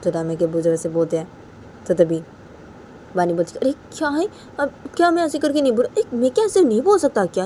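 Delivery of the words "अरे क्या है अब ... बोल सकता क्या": high, indignant comeback; acted protest. Bani answering Raima's abbu back, high acted protest. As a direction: argue back in a raised voice.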